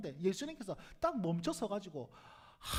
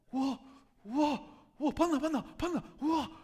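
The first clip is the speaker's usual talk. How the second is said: loud, thrilled crowd chatter; excited gawking fans; 3 semitones higher, 4 dB louder, slower than usual. Retold or imitated. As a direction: gasp at the star like a fan.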